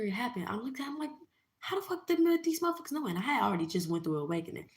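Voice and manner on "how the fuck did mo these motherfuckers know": shocked question. Retold or imitated. Asking husband how they knew, high shocked voice. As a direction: squeak out the disbelief.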